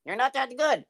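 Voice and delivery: high-pitched heckling puppet voice; very high, needling puppet; Jerry. Acted. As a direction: cut in as a cheeky ventriloquist's dummy. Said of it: "Jerry, I know".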